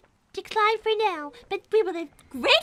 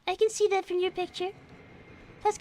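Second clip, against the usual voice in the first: ordinary talk, nothing marked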